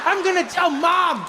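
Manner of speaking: put-on high-pitched child's accusation; indignant little kid. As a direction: tattle on father in a high wail. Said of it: "I know this kid was like".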